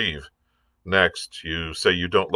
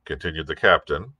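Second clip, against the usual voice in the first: ordinary delivery, nothing marked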